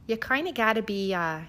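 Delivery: usual speaking voice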